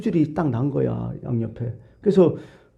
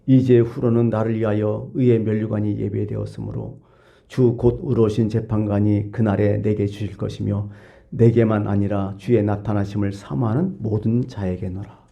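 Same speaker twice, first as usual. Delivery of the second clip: lower, measured Bible reading; scripture reading; 4 semitones lower than usual. Acted. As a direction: read Paul's words solemnly, slowing at the end. 바울의 성경 구절을 낭독. (낮고 엄숙한 성경 낭독)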